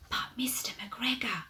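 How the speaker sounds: hushed, suspenseful whisper. Acted. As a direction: breathe the name like a secret.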